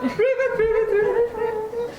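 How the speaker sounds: high rivet croaking; frog croak. Shown as a demonstration. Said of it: "How they're croaking".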